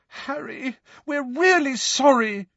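high, pleading, close to tears; desperate apology. A narrator's reading. Hermione apologising desperately to angry Harry, pleading. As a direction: Hermione begging to be forgiven. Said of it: "said Hermione desperately".

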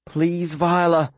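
slow tearful plea; nearly weep the words; almost weeping. Acted. Boy begging the drugged girl to move, near tears. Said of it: "I'm practically weeping it".

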